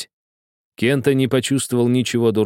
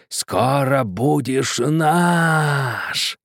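very slow, higher ghostly moan; moaning skeleton voice; 5 semitones higher, much slower than usual. Acted. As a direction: drag it out like a rattling skeleton. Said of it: "простонал скелет, клацая зубами".

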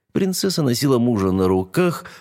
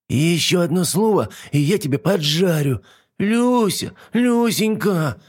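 squeaky dragon; 9 semitones higher than usual. A narrator's reading. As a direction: wail for help like a small dragon. Dragon threatens, calls princess.

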